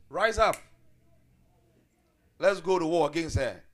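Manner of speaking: loud call to war; rallying call. Retold or imitated. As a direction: shout them into battle. Teacher mimics the call to war.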